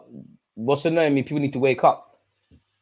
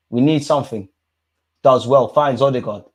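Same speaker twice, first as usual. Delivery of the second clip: ordinary talk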